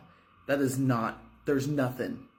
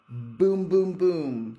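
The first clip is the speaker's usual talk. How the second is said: loud mouthed gunfire booms; gunshot booms; 5 semitones higher, 6 dB louder, much slower than usual. Acted. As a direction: blast out each boom like gunshots. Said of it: "American Cop style".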